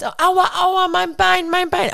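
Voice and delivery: high, wailing child's fake pain; child faking a hurt leg, high and whiny; mock child's whine. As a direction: whimper like a hurt little boy. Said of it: "Er hat gesagt".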